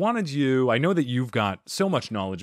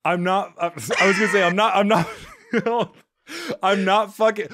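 flustered; 8 semitones higher, 5 dB louder than usual. Real, not acted. Host flustered, denying under questioning.

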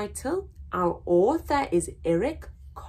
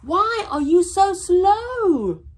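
loud, high, blunt monkey question; much louder and higher monkey; howler monkey; 9 semitones higher, 11 dB louder than usual. Acted. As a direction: shout it like a cheeky monkey. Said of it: "the howler monkey asked".

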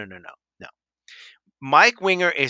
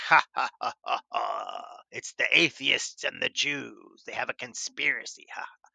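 sinister put-on villain laugh, then hushed plotting; mock conspiracy plotter; 5 dB quieter than usual. YouTuber mocking the conspiracy theory about historians, hammed-up plotter.

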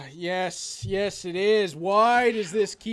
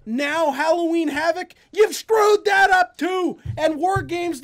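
outraged shout; 7 semitones higher, 7 dB louder than usual. Real, not acted. Rant about ruined wrestling legacy.

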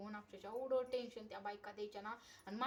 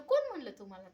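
sharp demand; 2 semitones higher, 10 dB louder than usual. Retold or imitated. Wife confronts husband, retold.

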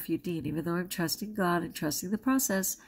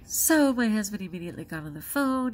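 ordinary talk, nothing marked

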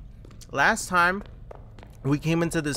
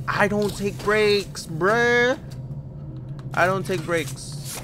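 defiant; 7 semitones higher, 3 dB louder, slower than usual. Acted. Defiant reply to game prompt.

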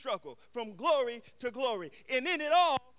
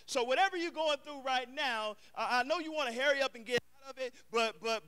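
ordinary delivery

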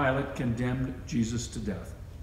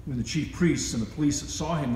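ordinary delivery

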